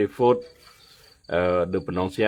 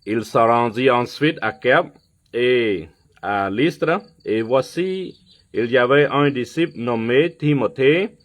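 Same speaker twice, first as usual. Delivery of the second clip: higher and louder scripture reading from the pulpit; preaching; 4 semitones higher, 5 dB louder than usual. Acted. Pastor reading Acts sixteen aloud to his church, preaching voice. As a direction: proclaim the verses to the church.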